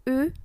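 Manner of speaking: "U" said as "ü"; French pursed U. Shown as a demonstration. Teacher showing how U is read, pursed French vowel. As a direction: say "U" as an "i" through lips rounded for "u".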